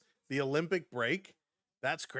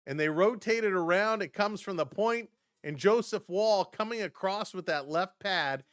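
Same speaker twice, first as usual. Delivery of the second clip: ordinary talk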